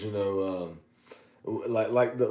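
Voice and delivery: usual speaking voice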